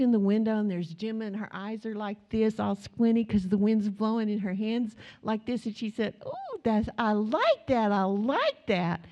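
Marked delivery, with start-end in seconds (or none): 6.3-9.0 put-on little girl's delight. Granddaughter enjoying wind in car.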